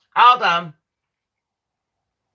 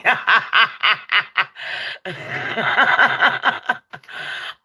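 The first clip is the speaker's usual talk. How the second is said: real wheezy fit of laughter; long helpless laugh. Real, not acted. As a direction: crack up and keep laughing. Cracking up at own admission.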